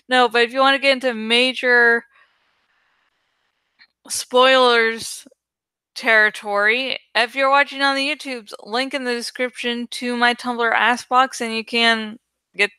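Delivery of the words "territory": normal talking voice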